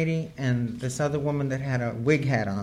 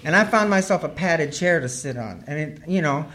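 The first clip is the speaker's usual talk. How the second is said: usual speaking voice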